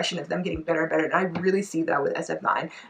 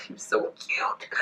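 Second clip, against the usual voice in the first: whispered gush; 7 dB quieter, slower than usual. Real, not acted. Fangirling over her bias.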